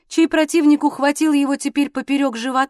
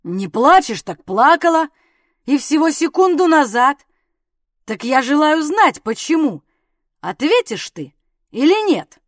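loud, insistent demand; angry, hectoring questioning; bullying interrogation; 3 semitones higher, 6 dB louder than usual. A narrator's reading. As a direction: press hard for an answer, voice raised. Husband bullies wife for an answer.

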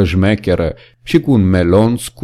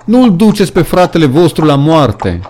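higher, louder desperate plea; pleading shout; 7 semitones higher, 3 dB louder than usual. A narrator's reading. Animals begging the horses to spare Boxer, shouting. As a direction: beg them loudly to stop. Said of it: "țipară ele".

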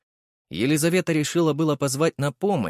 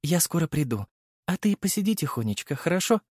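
tender; 5 semitones higher than usual. A narrator's reading. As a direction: speak gently to the baby. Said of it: "нежно улыбнулась".

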